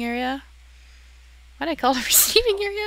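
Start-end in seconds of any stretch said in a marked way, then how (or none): 1.6-2.8 high, fast laugh-through question; high giggly disbelief at his own naming; laughing at himself. Real, not acted.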